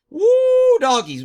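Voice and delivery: loud high whoop. Real, not acted. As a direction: let out a big whoop. Whooping at allergy money.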